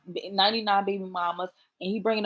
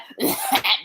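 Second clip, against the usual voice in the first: loud imitated choke; exaggerated eavesdropper's choke; mock choking; 4 dB louder than usual. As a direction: hack as if choking on words. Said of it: "like, choke".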